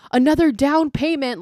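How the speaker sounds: high, loud zealot taunt; a fanatic's loud, high taunt after being slapped; eerie taunt. Retold or imitated. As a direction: crow it out like a madwoman. Bleeding zealot taunts the crowd.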